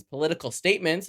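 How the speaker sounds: ordinary talk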